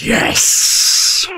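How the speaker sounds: long hissed whisper; voiceless drawn-out demonic hiss. Retold or imitated. Demon answering the frightened narrator, hissed whisper. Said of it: "then you hear like".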